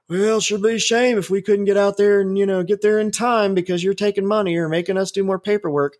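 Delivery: a touch of mock self-pity, parroting their excuse; mocking police union. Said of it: "what do they say".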